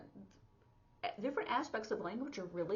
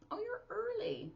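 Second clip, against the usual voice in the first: high, pleased surprise; surprised "oh you're early". Shown as a demonstration. Teacher demonstrating the sign in a surprised greeting. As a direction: greet someone who came early. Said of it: "but mostly early".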